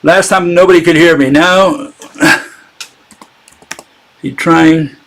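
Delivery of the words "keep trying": normal talking voice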